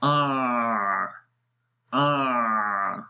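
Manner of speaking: आ let run on too long and loose; wrong, overflowing ain. Shown as a demonstration. Teacher showing the mistake of releasing ain too much. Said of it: "बहुत ज़्यादा आवाज़ जारी कर देनी है".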